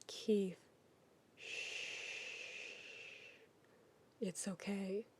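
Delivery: soft, hushed comforting whisper; calm him down gently; soothing hush. Acted. Shiro soothing Keith through his panic, whispering.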